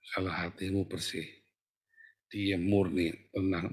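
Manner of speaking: soft, low, stilled voice; hushed calm. Shown as a demonstration. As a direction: drop right down into stillness. Describing a clean, quiet, calm heart. (lirih, rendah dan tenang)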